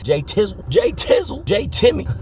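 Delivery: silly voice. Acted. Playful name riff in silly voice.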